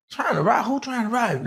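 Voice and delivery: high, rising who's trying to rob; alarmed and wanting answers; high, panicky questioning; alarmed disbelief. Retold or imitated. Homeowner alarmed by robbery.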